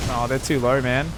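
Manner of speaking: usual speaking voice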